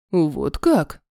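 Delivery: low, curious man's voice; interested major. A narrator's reading. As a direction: perk up, low and intrigued. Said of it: "неожиданно заинтересовался". (заинтересованный низкий голос)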